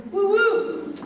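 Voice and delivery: high-pitched, playful "woo woo"; excited whoop. Real, not acted. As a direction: let out a quick high cheer. Cheering after saying she's excited.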